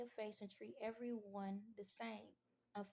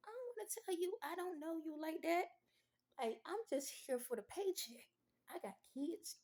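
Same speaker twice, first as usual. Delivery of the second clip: annoyed inner voice; 7 semitones higher than usual. Retold or imitated. Inner reply to nosy relator customer.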